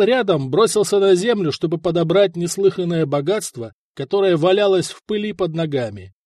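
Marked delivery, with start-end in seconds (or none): none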